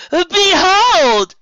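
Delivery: booming, high stage cry; dramatic shout. Acted. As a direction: announce it to the whole room. Trixie's dramatic proclamation.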